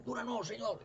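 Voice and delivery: high, begging plea of the sick; pleading cry. Retold or imitated. Sick crowd begging Jesus, high pleading cry. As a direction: call out to the Lord for healing.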